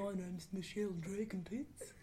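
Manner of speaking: Michelle. Acted. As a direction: introduce the character quietly, half laughing. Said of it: "What if we had Michelle?".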